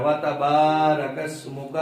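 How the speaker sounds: slow وَتَبَارَكَ اسْمُكَ with با held long; long vowel. Shown as a demonstration. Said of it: "اس با کو بھی لمبا کرنا ہے". (وَتَبَارَكَ اسْمُكَ، با کو لمبا کر کے)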